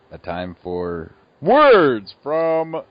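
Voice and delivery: loud, drawn-out segment title; mock announcer. Host introducing a listener segment, mock announcer. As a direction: announce it big, stretching the first words.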